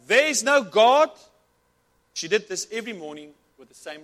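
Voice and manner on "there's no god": slow, loud, high shouted denial; yelled retort. Acted. Atheist neighbour yelling back at her, slow and loud. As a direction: holler it back over the fence. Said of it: "This atheist held back".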